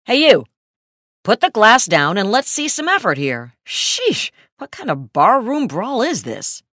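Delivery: high, loud irate shouting; dopey cartoon bellow. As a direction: bellow at him like an irate Italian. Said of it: "like an irate Italian".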